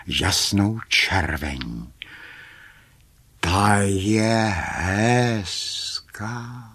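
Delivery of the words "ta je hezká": very slow, drawn-out marvelling; understated wonder, long drawn vowels; hushed wonder. A narrator's reading. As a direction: marvel slowly, stretching each word. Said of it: "divili se, ale tiše".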